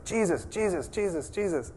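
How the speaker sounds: nagging plea. Retold or imitated. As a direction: nag him over and over by name. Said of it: "She's nagging".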